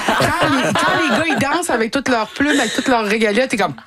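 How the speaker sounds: high giggling, teasing tone; playful laughing admiration. Real, not acted. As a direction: laugh while you tease about the dancers. Laughing while describing male dancers.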